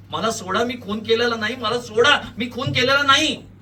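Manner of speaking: frantic repeated shouting. A narrator's reading. Held suspect protests innocence, shouting frantically. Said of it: "वेड्यासारखं परत परत ओरडत राहता".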